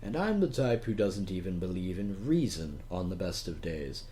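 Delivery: ordinary delivery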